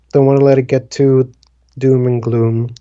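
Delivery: ordinary talk, nothing marked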